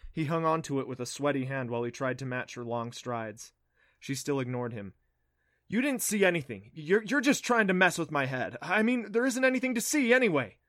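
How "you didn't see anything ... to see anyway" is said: defensive. Acted. Boy denies anxiously.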